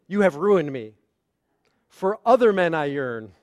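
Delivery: full stop after "ruined me", long pause, then "For other men I yearn"; accusing phrasing. Shown as a demonstration. Rabbi reading John's misreading, accusing phrasing. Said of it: "but what did John read?".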